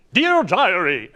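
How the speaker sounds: loud, high, histrionic declaiming; overblown ham actor. Retold or imitated. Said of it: "everything was so overblown".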